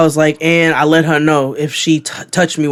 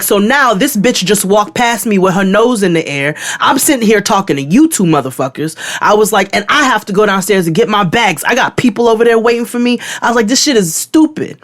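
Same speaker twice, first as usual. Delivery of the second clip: raised pitch, indignant re-enactment; heated mock complaint; retold angry rant; 7 semitones higher than usual. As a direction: act out being fed up with the agents. Acted angry rant at agents.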